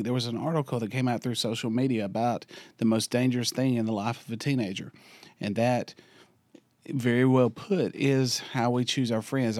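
low, soft and worn-out voice; lost voice. Real, not acted. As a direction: talk through a voice that's nearly gone. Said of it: "my voice isn't quite what it's supposed to be".

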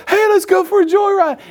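high-pitched, eager group call; hammed-up teenage enthusiasm; excited teens. Acts out excited teens in video.

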